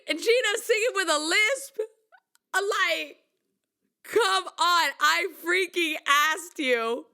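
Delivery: high, incredulous half-laughing protest; mock outrage. Singer objecting to the lisp article, mock outrage. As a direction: complain in hammed-up disbelief.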